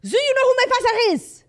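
very high, loud outburst in a put-on French accent; hammed-up French-accented indignation; mock French accent. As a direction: wail it like an entitled drunk Frenchman. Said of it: "He's French".

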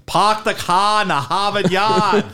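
high, slow, hammed-up Boston accent. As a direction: drag out an exaggerated Boston accent mockingly. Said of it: "he does, like, a Boston accent".

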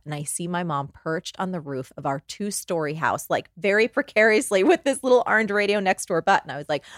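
ordinary talk, nothing marked

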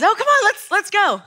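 high, eager come-on call; rally the room like a cheerleader; hyped cheer. Shown as a demonstration. Showing the crowd what her signal means, hyped call. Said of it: "So when I do this, it means".

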